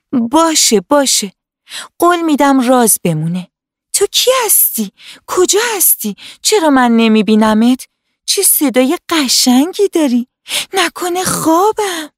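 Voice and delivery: put-on a little girl bubbling with wonder; excited little girl. Hana questions the hidden tree.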